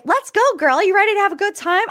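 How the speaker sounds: high, quick hype-up; rally your friend, fast; hyped invite. Retold or imitated. Lindsay hyping Morgan up, high quick excitement.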